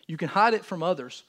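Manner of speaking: ordinary delivery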